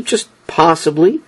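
usual speaking voice

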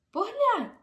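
high, loud cry of look; the horse points something out, eager; excited call. Acted. Little horse calls out to Giovanni, excited.

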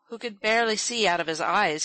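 usual speaking voice

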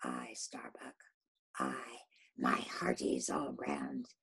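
low, hushed, slow admission; grim confession. Acted. Captain confesses to his crew, low and hushed. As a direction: admit it heavily.

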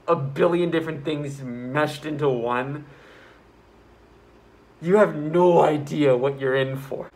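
ordinary delivery

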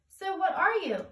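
Cricket voice. Acted. Cricket asking in story.

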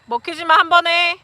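loud sideline shout; yelling across the room. Real, not acted. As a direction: shout it to the players. Coach yelling instructions during game.

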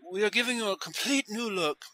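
high-pitched hairdresser impression; Jose Eber. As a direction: put on a soft, high stylist's sales patter. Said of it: "he'd always tell these women".

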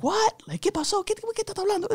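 high, startled mom voice mixing Spanish; mama's voice. Retold or imitated. Mama questioning her son, high alarmed mock mom voice. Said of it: "she was just like".

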